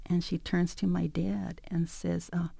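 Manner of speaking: plain reading voice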